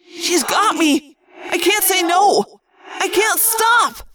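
halting, then building to a high, drawn-out cry; helpless plea that climbs into an anguished shout. A narrator's reading. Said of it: "he cried in anguished response".

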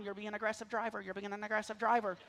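soft, hushed wife calming him down. Retold or imitated. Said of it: "My wife's gotta calm me down".

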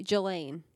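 Ghislaine as juh-LAYN; soft-G pronunciation. Shown as a demonstration. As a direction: say Ghislaine with a J sound first. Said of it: "or they say".

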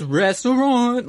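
drawn-out, high show-tune Restaurant; goofy theatrical voice. Retold or imitated. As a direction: stretch it like a stage actor. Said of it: "the way he says".

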